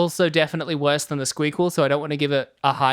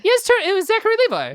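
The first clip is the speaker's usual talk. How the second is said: insistent shout; faster than usual. Real, not acted. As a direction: shout it, sure you're right. Arguing over the actor's name.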